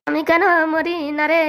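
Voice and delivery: slow, drawn-out lament; despairing wail. Acted. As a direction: wail in self-pity. Bullied husband laments his life.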